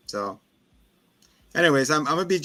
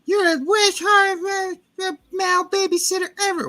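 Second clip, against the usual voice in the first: put-on whiny kid voice; 5 dB louder than usual. Boy to his babysitter, a high bratty yell. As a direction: shout it like a spoiled kid insulting his sitter. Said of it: "He's like".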